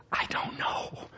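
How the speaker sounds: breathy, voiceless aside; whispered uncertainty. Acted. Preacher admitting doubt to the congregation, whispered. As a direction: whisper it, drawn out.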